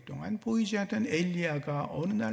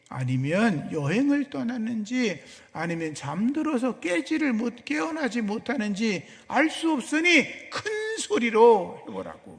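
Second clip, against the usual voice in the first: raised, goading jeer, building louder then dropping off at the end; mocking taunt; 6 semitones higher, 6 dB louder than usual. Elijah jeering at the prophets of Baal over their silent god, mocking. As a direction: taunt them with mock helpfulness, pitch climbing. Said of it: "엘리야가 그들을 조롱하며 비웃습니다".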